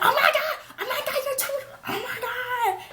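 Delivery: hammed-up high squealing panic; over-exaggerated shock. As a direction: play the friend's hysterics way over the top. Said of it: "she's just naturally over-exaggerant".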